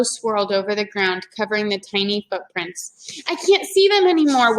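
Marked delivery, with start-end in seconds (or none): 3.3-4.6 put-on high, louder distressed girl; wailing. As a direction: let Annie wail in the wind. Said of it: "wailed Annie".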